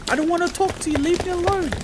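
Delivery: high, tearful begging; tearful plea. Acted. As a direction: plead through tears to be left alone. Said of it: "Tears pouring down his face".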